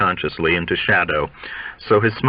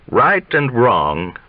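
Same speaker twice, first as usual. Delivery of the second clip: slow weighty start, then stop; slow and halting; 3 semitones higher, much slower than usual. Acted. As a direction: lay it down slowly, then break off. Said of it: "he says, and stops".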